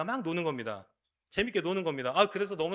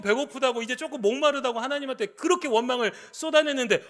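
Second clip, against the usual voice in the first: higher, louder indignant objection; incredulous protest; 9 semitones higher, 5 dB louder than usual. Acted. Jeremiah protesting to God, higher and louder in disbelief. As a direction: push back at God in disbelief. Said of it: "라고 반문할 때".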